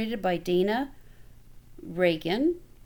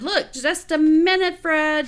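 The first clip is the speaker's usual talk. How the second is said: higher, louder brush-off; busy brother; 6 semitones higher, 6 dB louder than usual. A narrator's reading. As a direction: shout it mid-game. Brother mid-game brushes off.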